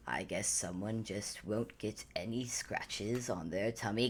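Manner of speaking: whispered sly bait to a pet; teasing whisper. Acted. Ansel coaxes the hiding cauldron out, in a teasing whisper. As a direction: whisper the tease like luring a dog.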